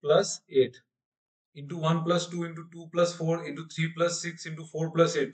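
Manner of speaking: very slow, spelling out each step; teacher dictating a series, very slow; slow dictation. Shown as a demonstration. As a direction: read the series out slowly for students. Teacher reads series slowly.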